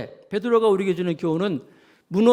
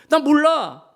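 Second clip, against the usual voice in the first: frightened denial; 9 semitones higher, 4 dB louder than usual. Acted. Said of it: "베드로가 겁이 나가지고".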